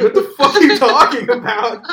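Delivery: loud laughing disbelief. Real, not acted. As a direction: laugh it out incredulously. Laughing at absurd story.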